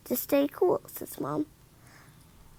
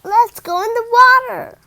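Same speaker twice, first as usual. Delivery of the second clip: eager brother; 8 semitones higher, 10 dB louder than usual. Acted. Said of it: "Bob says".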